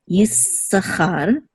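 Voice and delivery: "Yisachar" slow, held S, stressed final char; accent on Cha. Shown as a demonstration. Teacher models the accented name, "Yisachar" slowly, stressed at the end. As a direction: hold the S in "Yisachar" and weight the char. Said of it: "the accent is on Cha".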